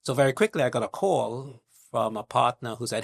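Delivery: usual speaking voice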